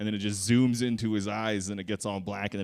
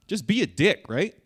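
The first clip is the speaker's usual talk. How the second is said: ordinary talk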